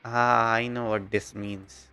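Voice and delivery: normal talking voice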